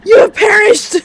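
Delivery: loud, high, booming game over; dramatic announcer. Acted. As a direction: proclaim it like a doom announcer. Game over screen read aloud.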